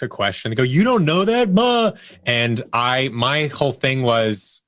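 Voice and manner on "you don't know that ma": high, louder mock-incredulous jeer; imitating a mean know-it-all, pitched way up; mocking disbelief. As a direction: scoff at someone for not knowing. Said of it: "there are mean people out there".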